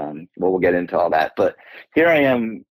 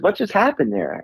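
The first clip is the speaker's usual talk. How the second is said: therapist asking; 11 semitones higher than usual. Retold or imitated. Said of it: "she goes".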